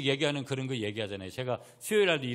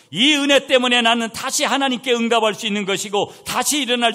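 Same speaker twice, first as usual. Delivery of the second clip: loud, high, driving pulpit delivery; forceful preaching; 9 semitones higher, 12 dB louder than usual. Real, not acted. Preacher proclaiming God's second chances, impassioned preaching. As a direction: proclaim it to the whole congregation.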